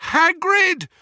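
desperate high yell over engine roar; anguished denial. Acted. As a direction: scream it, refusing to accept it. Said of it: "he yelled over the thunderous roar".

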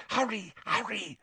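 high, barely voiced urging; mouthed. A narrator's reading. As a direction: mouth it urgently, almost without sound. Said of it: "she mouthed".

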